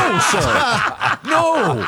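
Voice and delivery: high, loud laughing no, sir; laughing protest. Real, not acted. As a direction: burst out laughing in disbelief. Laughing disbelief at the story.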